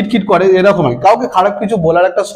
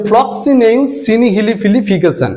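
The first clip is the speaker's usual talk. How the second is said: ফ্লক্সিনেউ সিনিহিলিপিলিফিকেশন run together as one word; connected pronunciation; 4 semitones higher, slower than usual. Shown as a demonstration. Teacher saying the funny-sounding word to students, joined up. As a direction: say the long word through without syllable breaks. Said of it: "এটাকে উচ্চারণ করলে একটু".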